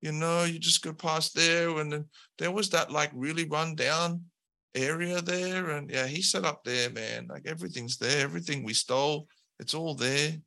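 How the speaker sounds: slacker henchman. Acted. Lackey telling the heroes where the king lives, high and offhand. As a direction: toss out the directions without caring. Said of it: "He's like".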